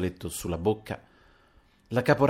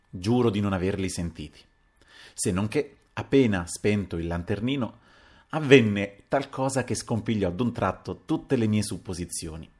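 plain reading voice

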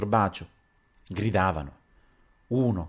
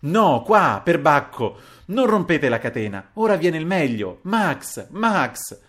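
loud, high-pitched exasperated orders; raised voice, urgent and annoyed; very irritated shouting; 6 semitones higher, 6 dB louder, slower than usual. A narrator's reading. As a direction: shout in vexation, then call out high. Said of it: "il Paleari gridava irritatissimo".

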